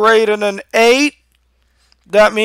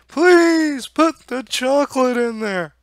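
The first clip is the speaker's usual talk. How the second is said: pleading viewers; 9 semitones higher, much slower than usual. Retold or imitated. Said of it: "some of you guys go".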